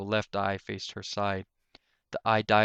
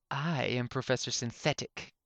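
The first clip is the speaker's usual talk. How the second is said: a hint of a proud inventor; lightly voiced haughty professor; 3 semitones higher than usual. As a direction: announce yourself coolly. Professor introduces himself.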